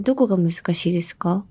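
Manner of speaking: ordinary delivery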